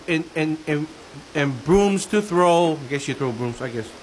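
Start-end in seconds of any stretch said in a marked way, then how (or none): 2.8-3.9 low muttered aside. Real, not acted.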